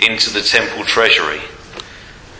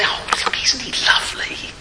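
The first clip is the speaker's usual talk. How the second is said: high, hushed, fawning gush; admiring onlookers; 12 semitones higher than usual. Acted. Preacher mocking the world fawning over a rich giver. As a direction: coo over him like an adoring crowd. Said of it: "the world looks on and says".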